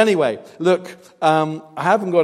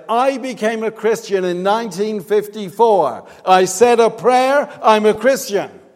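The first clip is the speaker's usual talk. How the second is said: loud, higher imitation of an offended woman; indignant protest; 6 semitones higher, 8 dB louder than usual. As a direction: insist on it, mock-indignant and loud. Acts out woman's defensive answer.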